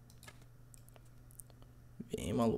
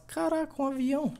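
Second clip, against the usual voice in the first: amazed; 10 semitones higher than usual. Real, not acted. Amazed again at the plane.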